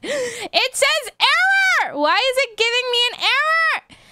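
high-pitched, wailing complaint; distressed whine. Real, not acted. Scene: streamer facing an error screen, high wail of dismay.

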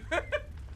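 high breathy laugh; burst of laughter. Real, not acted. Laughs at consequences.

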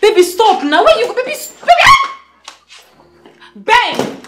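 loud, desperate calling. Acted. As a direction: call after him, pleading. Wife calling after leaving husband.

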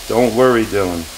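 ordinary delivery

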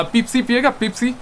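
"Pepsi" said as "peepsee"; Pakistani pronunciation. Shown as a demonstration. Streamer giving another example, "Pepsi" with ee in both syllables. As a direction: lengthen the first vowel of "Pepsi" to ee. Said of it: "there's a little bit of difference".

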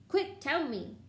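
high, urgent order; quick demand. A narrator's reading. Filch demanding Peeves reveal the students, urgent. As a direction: press him for an answer at once. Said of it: "Filch said quickly".

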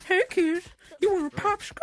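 very loud, high put-on voice; hammed-up shouted offer to lure a child; put-on luring stranger. Player offering a kid a popsicle, put-on luring character voice.

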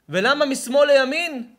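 loud, high-pitched sermon delivery; fiery preaching. Acted. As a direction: preach it hard to the crowd. Preaching at full force.

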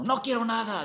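high, loud "No quiero nada"; stern refusal. Retold or imitated. Guru refusing the juice from his servant, loud and stern. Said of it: "le habló muy fuerte".

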